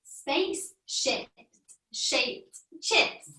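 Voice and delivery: slow, quiet attempt at Spaceship shaped chips; careful try. Shown as a demonstration. Child attempts the tricky phrase for the teacher, slowly. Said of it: "Good try".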